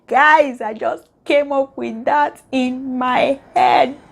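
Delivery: high, slow, drawn-out exclamation; amazed boasting. Real, not acted. Telling viewers she invented the saying, amazed and boastful. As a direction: marvel at your own cleverness, stretching the words.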